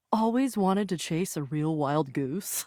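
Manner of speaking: low, slow confession; low and lingering, owning up to a dream; confiding. Acted. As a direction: draw it out low, like a cherished secret. Confides a long-held wish.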